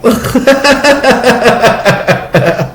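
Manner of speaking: high, breathy giggle; giggling. Real, not acted. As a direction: let the giggle spill out. Laughing after own impression.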